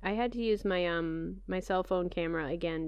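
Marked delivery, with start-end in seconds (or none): none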